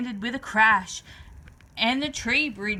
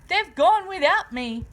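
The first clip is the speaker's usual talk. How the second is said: higher, louder wail of being left; crying out; 6 semitones higher, 5 dB louder than usual. A narrator's reading. Drip left behind by his sisters, wailing. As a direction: call after them in dismay. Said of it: "And he cried".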